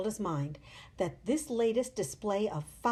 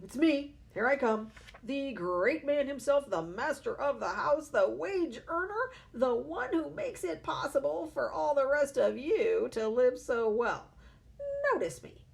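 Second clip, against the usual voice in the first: loud and higher, puffed up with self-importance; pompous booming father; 5 semitones higher, 4 dB louder than usual. A narrator's reading. Father barging into the breakfast room, loud and pompous. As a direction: announce yourself like the great man of the house. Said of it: "One could almost hear him saying".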